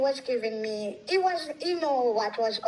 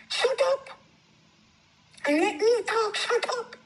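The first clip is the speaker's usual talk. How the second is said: high-pitched angry retort; retold yelling; 12 semitones higher than usual. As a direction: re-enact being yelled at, pitched way up. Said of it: "Yell at me".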